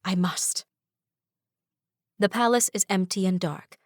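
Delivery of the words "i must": ordinary delivery, nothing marked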